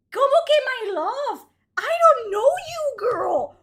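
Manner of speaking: loud, high indignant protest; indignant shout. Real, not acted. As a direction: push back at her loudly. Outraged at romance again.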